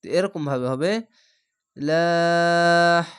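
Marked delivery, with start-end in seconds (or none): 1.8-3.0 লাহ drawn out long and light, loud and held; light thin lah. Shown as a demonstration. Teacher demonstrating the thin Allah sound to students, long and light. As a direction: stretch লাহ thin and even. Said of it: "এভাবে থামবেন".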